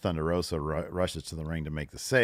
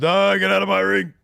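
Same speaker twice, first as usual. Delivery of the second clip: higher, louder hammed-up defiance; mock angry Hook; 12 semitones higher, 10 dB louder than usual. Host voicing Hook throwing Jericho out, higher and louder. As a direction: bark it like a young tough guy.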